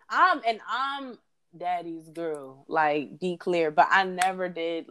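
ordinary talk

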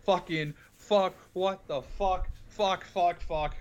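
a string of mock-surprised curses, pitch jumping up; mimicked swearing scene. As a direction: rattle off the curses like the movie cop. Mimicking the all-fuck movie scene.

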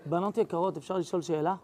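low, quiet, suddenly solemn ask; sudden serious tone. Retold or imitated. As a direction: drop the smile and ask gravely. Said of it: "בטון הכי לא קשור למה שהיה עד עכשיו".

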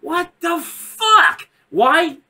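high, slow, drawn-out incredulity; exasperated disbelief. Retold or imitated. Fan protesting a plot hole, exasperated disbelief. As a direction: stretch it out, utterly baffled.

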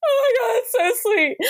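high, tearful mock sob of gratitude; reading a client's review, choked up and high; teary gush. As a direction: gush like the review made you cry.

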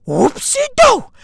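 high, slow silly voice; silly whoopsie. Acted. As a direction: sing out a goofy oops. Playful self-correction.

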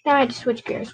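ordinary delivery

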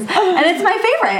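laughing high-pitched. Real, not acted. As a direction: say it through a laugh. Laughing about favourite book.

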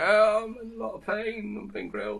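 high put-on voice of a man being grilled; ventriloquist voice. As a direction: squeal it like roasting meat. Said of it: "he can kind of go".

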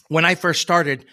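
ordinary talk, nothing marked

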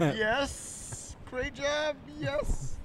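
high, soft breathy moan of yes; mock-moan like someone enjoying it; hammed-up soft moaning approval; moaning praise. Acting out a blowjob reaction.

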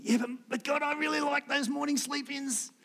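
high, mock-whiny excuse to God. Preacher pleading with God to keep his sleep-ins, whiny. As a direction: whine like a sleepy kid begging off.

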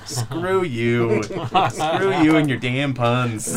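slow, drawn-out mock outrage; mock exasperation. Real, not acted. GM groaning at the player's pun, slow and mock-outraged. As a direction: groan it out in fake disgust.

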